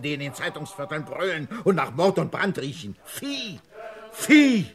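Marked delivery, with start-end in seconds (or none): 3.0-3.6 leises, angewidertes Ausspucken des Wortes „Vieh“; „Vieh“ gedämpft und voller Ekel; verächtlich gezischt. Acted. Contemptuous outburst at the rabble. 4.1-4.7 „Vieh“ laut und hoch herausgebrüllt; wütender Schrei. Acted. Contemptuous outburst at the rabble.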